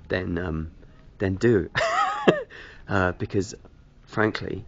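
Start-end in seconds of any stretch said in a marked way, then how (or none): 1.7-2.8 loud, very high-pitched giggle; high laugh. Real, not acted. Vlogger after asking viewers for help, high laugh.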